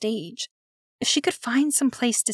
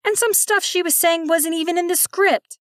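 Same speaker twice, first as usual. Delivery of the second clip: much higher piping villain voice; shrill barracuda voice; 8 semitones higher, 3 dB louder than usual. Acted. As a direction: pipe in like a wicked sea creature. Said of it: "the wicked barracuda of the West piped in".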